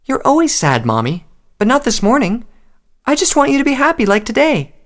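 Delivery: very high, plaintive filly voice; pouting child. A narrator's reading. Filly wants her mother happy, pouting and pleading. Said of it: "The filly just pouted even more".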